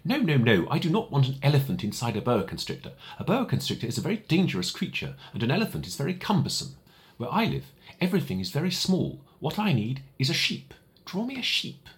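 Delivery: put-on a small boy correcting a grown-up; high, earnest little prince refusing; little prince, insistent. Little prince rejects drawing.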